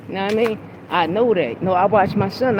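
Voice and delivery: normal talking voice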